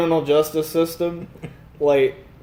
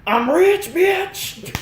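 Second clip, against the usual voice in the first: mock rich brag; much slower than usual. Quoting the skit's catchphrase, high and bragging. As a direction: crow it high.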